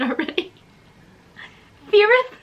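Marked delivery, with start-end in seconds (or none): 1.9-2.3 Feareth? echoed high and loud; incredulous repeat. Real, not acted.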